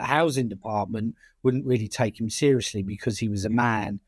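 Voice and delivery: ordinary talk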